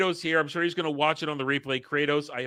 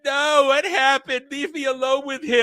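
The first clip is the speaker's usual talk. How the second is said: high, loud mock anguish; hammed-up grieving cry; 8 semitones higher, 5 dB louder than usual. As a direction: wail over the body, overacted. Kid grieving over the body.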